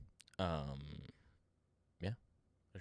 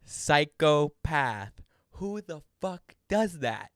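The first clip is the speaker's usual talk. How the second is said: loud, slow, disbelieving; outraged shout; 5 semitones higher, 11 dB louder, much slower than usual. Real, not acted. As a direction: yell it in disbelief. Outraged at [name].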